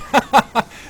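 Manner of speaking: high, loud burst of laughter. Real, not acted. Laughs at marines.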